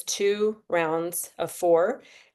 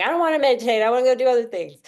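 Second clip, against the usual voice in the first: higher, louder whiny mind voice; restless mind; 6 semitones higher, 6 dB louder, faster than usual. Retold or imitated. Teacher voicing a restless mind to her breathwork class, whiny. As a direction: play the mind that won't sit still. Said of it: "your mind's being like".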